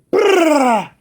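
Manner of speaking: loud, high rolled "brrr"; explosive flapping take-off noise; imitated wing flutter. One bird suddenly leaves the cable, imitated flutter.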